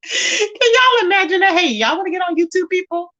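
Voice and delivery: high voice squeaking with laughter; laughing hard. Real, not acted. Speaker imagines inviting eavesdropping neighbors, laughing hard. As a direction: barely get the joke out for laughing. Helpless laughter at her own joke.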